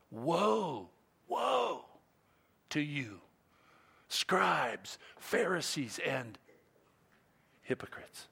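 soft, half-whispered solemn woe; hushed quote. Retold or imitated. Preacher quoting Jesus' woes to the Pharisees, softly. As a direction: let the warning sink, almost breathless.

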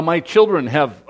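ordinary delivery

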